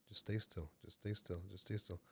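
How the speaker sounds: very soft, low repeated urging; quiet, steady words under the breath; soothing inner voice. Retold or imitated. Inner voice steadying the threatened man, hushed and low. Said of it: "and then that voice says".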